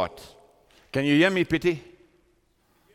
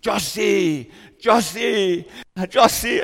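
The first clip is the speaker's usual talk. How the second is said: imitating a father calling for his lost child, higher and louder, building; raised, urgent acted calls; crying out; 5 semitones higher, 4 dB louder, much slower than usual. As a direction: call the name out as if searching through rubble. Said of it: "he started crying out".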